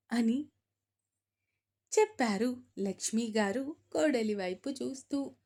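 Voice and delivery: ordinary delivery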